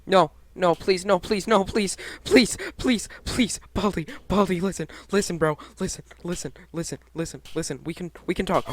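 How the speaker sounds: loud frantic pleading; anguished denial. Real, not acted. Begging Baldi after being caught.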